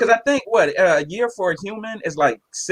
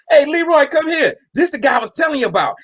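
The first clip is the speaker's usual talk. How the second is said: calling out; 10 semitones higher than usual. Retold or imitated. Guy calls over his friend.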